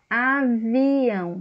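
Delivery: avião drawn out slowly and clearly; slow demo word. Shown as a demonstration. Teacher showing pupils the word, slow and clear. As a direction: spell out avião slowly for the class. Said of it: "Como é que a gente coloca".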